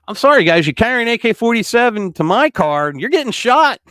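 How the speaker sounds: loud, high-pitched, unapologetic declaration; defiant warning. Real, not acted. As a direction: raise the voice and lay down the law. Defiant warning to imagined armed protesters.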